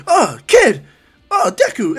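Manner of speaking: loud, high startled greeting; high-pitched burst of surprise. Acted. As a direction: react as if someone popped up beside you. Startled announcer greets kid in portal.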